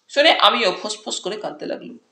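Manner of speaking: plain reading voice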